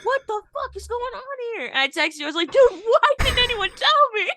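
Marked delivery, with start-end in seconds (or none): 0.0-1.7 high-pitched reenacted disbelief; bewildered. Retelling shock at the accent. 2.5-4.3 high, loud mock outrage; indignant disbelief. Retelling shocked text to friend.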